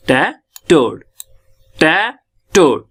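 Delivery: "टै" rising, a pause, then "टोड" falling; rising then falling syllables. Shown as a demonstration. Teacher showing stress in tattooed, "टै" rising, "टोड" falling. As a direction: lift "टै", let "टोड" drop. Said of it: "rising and falling pitch दिखाएंगे".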